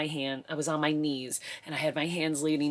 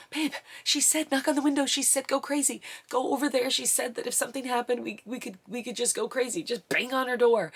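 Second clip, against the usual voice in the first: high-pitched, rushed urging; urgent pleading; 7 semitones higher than usual. Retold or imitated. Wife urging husband to bang on the neighbor's door, frantic. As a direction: push him to go now, pitch up high.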